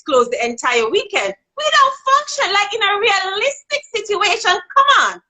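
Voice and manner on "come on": ordinary talk, nothing marked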